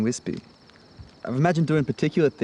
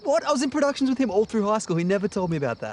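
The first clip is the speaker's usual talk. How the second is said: surprised; 10 semitones higher than usual. Real, not acted. Shocked to hear about Jonathan.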